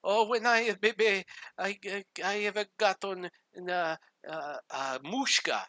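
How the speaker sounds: high voice with broken Italian-tinged English; imitating grandma. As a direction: imitate an old Italian grandmother groping for words. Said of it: "she would say".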